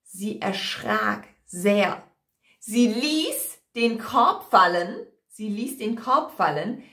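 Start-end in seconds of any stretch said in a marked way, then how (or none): none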